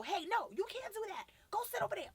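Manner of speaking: loud, high-pitched barked orders; imitated yelling. Young youth worker ordering kids around, imitated yelling. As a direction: act out your old yelling at kids. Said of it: "I was yelling".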